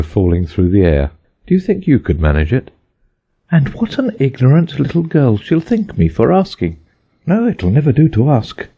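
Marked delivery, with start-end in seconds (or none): 3.5-8.7 put-on higher girlish voice, fretting; a little girl embarrassed at herself; young Alice. A narrator's reading.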